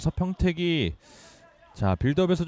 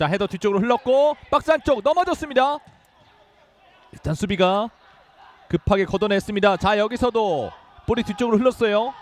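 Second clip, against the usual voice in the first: excited call; 4 semitones higher than usual. Real, not acted. Frantic goalmouth scramble.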